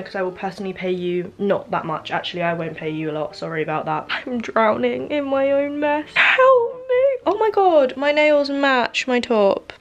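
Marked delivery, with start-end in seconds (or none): 4.1-7.1 high loud mock plea for help; mock despair. Mock distress. 7.3-9.7 high loud delighted gasp; excited. Real, not acted. Excited discovery.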